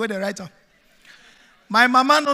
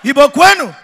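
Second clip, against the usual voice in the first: rallying call; 8 dB louder than usual. Real, not acted. Calling out to crowd.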